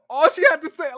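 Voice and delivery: ordinary talk, nothing marked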